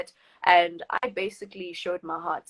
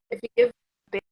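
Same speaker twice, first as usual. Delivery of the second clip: choppy breaking-up audio; call dropping out; 3 dB louder, faster than usual. Real, not acted. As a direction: voice cutting in and out. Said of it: "you're cutting a bit".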